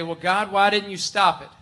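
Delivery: reproachful plea. Acted. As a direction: build from complaint to loud reproach. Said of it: "And we could say".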